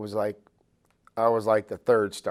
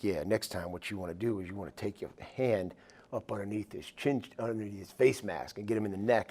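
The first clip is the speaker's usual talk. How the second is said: lightly imitated deep voice, much softer; 10 dB quieter than usual. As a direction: softly mimic a big veteran's deep-voiced advice. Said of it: "in his deep voice".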